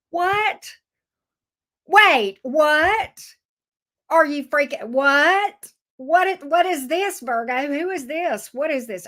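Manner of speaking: can't believe the cards, voice up high; excited disbelief. Real, not acted. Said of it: "I'm so excited I can't even read the damn cards".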